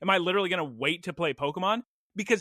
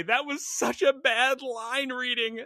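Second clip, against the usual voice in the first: exasperated critique; 7 semitones higher than usual. Retold or imitated. Viewer pausing a movie, exasperated and laughing. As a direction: scoff at the bad line reading. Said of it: "pause the movie and say".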